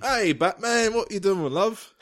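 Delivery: high Cockney butler impression; Michael Caine. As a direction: hail him in high comic Cockney. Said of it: "I'm Michael Caine".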